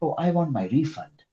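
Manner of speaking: polite customer tone; polite request. Shown as a demonstration. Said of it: "Now my tone is better".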